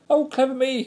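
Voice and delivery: high, loud rat squeal. A narrator's reading. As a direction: squeal it with gleeful self-praise. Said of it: "squealed in a voice".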